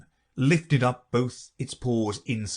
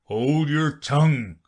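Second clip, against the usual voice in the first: louder, slow gruff order; stern Gryphon; 5 semitones higher, 6 dB louder, slower than usual. Acted. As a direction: bark it out. Gryphon snaps.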